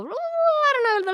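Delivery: mock reactive whine. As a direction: whine it high and drawn out, hammed-up. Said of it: "I used to be reactive to it and go".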